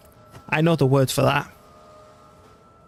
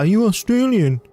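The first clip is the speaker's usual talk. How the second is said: higher, mock-curious stranger's question; mock disbelief, pitched up; imitated incredulous question; 7 semitones higher, 4 dB louder than usual. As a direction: imitate someone asking in surprise. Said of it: "Australian's been the funniest, funniest one so far".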